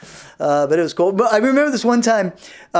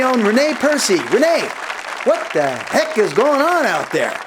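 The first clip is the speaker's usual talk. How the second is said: higher, upbeat host announcement; talk show host; 5 semitones higher than usual. Acted. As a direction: introduce the show to the audience.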